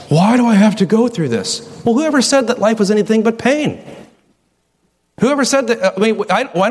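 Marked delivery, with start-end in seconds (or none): 0.1-1.6 complaining sufferer. Retold or imitated. A believer groaning to God about illness, whining plea. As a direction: voice the sufferer's lament, pleading. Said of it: "You're saying".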